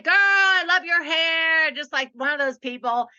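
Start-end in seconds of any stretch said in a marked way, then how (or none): 0.0-1.7 higher, louder and slow, a put-on voice; imitating gushing young guy. Woman retells young stranger praising her hair, mock gushing voice. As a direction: hams up the stranger's compliment, drawn out.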